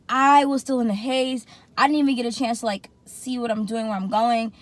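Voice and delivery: usual speaking voice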